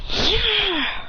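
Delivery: high, drawn-out mock yell; mock cry. Crowd being obliterated, high mock cry. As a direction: cry out high, no real volume.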